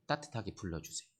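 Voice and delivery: ordinary delivery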